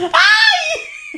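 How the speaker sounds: very high shriek breaking into laughter; a scared yelp melting into laughs; laughing shriek. Real, not acted. Cohost still shaken by the microphone, shrieks and laughs.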